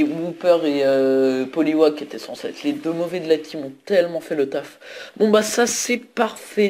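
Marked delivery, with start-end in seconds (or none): 5.2-6.7 higher, slow relieved delight. Real, not acted. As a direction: savour the win, beaming. Celebrating the win.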